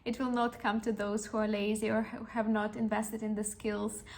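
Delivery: ordinary talk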